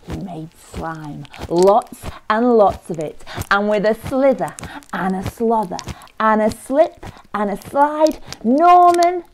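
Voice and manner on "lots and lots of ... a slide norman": rhythmic storytelling, building higher and louder; dramatic story narration. A narrator's reading. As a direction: build the excitement for young listeners.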